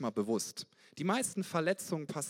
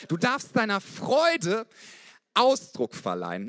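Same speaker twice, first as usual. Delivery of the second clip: slow and much louder, each word pushed; loud preaching; 6 semitones higher, 7 dB louder than usual. Real, not acted. Passionate preacher urging the congregation, loud and slow. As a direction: proclaim it to the whole church. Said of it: "Entschuldigung, wenn ich ein bisschen laut bin".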